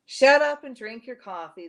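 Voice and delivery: loud gruff command; gruff order. Acted. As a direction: bark it out, half-joking. Wounded cop barks at shooter.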